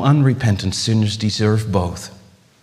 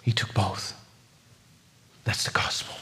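very soft, sinking to a whisper; hushed reverence; 12 dB quieter than usual. Real, not acted. Preacher telling the gospel's climax to the congregation, hushed. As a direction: let it fall to a near whisper.

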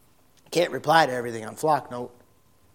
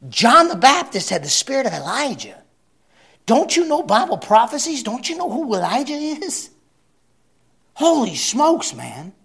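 loud, high preaching with a stretched exclamation; full-force preaching; 7 semitones higher, 8 dB louder than usual. Acted. Preacher asking the congregation about Elijah, full-force preaching. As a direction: rouse the crowd.

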